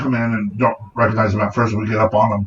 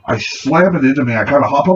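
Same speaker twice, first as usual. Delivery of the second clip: normal talking voice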